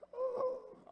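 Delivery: high laugh. Real, not acted. Laughs after own joke.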